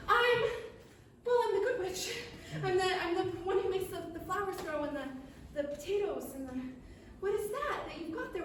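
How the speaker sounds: lightly voiced witch, asking eagerly; good witch. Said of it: "And she says".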